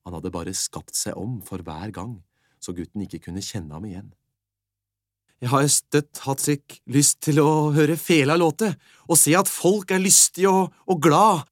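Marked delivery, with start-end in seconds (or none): 5.4-11.5 put-on slowish, much higher, louder boy voice; boy telling the beggar his wish, high and loud. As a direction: wish eagerly like a lad dreaming aloud. Boy wishing for a fiddle.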